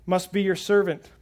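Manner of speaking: normal talking voice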